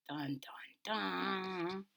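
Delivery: whisper. Pretend.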